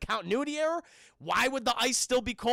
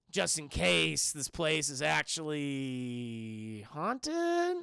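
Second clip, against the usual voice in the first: slow, drawn-out, rising on "haunted"; reluctant hedge; 4 semitones lower, much slower than usual. Retold or imitated. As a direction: stretch it out warily, lift the last word. Reluctant hedge about ghosts.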